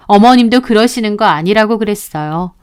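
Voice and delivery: usual speaking voice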